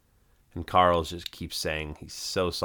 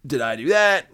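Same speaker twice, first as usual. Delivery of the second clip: high, loud sitcom nerd voice; squeaked-up, punchy comic question; catchphrase imitation; 7 semitones higher, 6 dB louder than usual. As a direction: pitch it up in mock innocence. Acts Steve's catchphrase after the accident.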